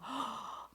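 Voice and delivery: sharp little gasp of wonder; demo gasp of awe. Shown as a demonstration.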